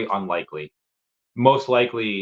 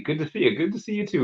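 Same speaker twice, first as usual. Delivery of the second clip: higher, softer sing-song hello; playful high greeting; 8 semitones higher, 4 dB quieter, faster than usual. Pretend. Streamer answers a fan's hello, high and playful. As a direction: toss the greeting back, high and light. Reading a viewer's greeting, replying.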